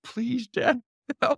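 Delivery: pleading daughter. Retold or imitated.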